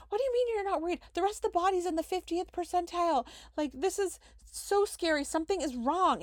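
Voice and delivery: high, frantic and pleading; re-enacting her own panic; panicked. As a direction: voice shoots up in alarm. Panicked reply to calm doctor.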